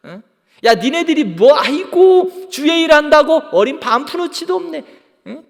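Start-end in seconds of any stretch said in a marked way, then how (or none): 0.6-4.8 loud, high-pitched scoffing; mocking ridicule. Preacher acting scoffers at those doing the Lord's work, mocking. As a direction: jeer as if they're hopeless. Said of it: "조롱하는 거야".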